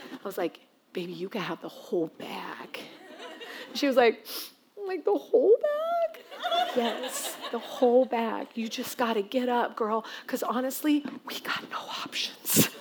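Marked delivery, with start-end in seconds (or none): 0.9-2.8 much softer, low coaxing; soft bribe. Retold or imitated. Mom bribing her tearful daughter, soft low coaxing. 4.8-6.2 put-on very high slow amazed little girl; amazed child. Daughter stunned by the marshmallow offer, high amazed child voice. 6.8-12.7 much softer, fading to a whisper; hushed pleading. Retold or imitated. Mom bargaining with her daughter, hushed pleading.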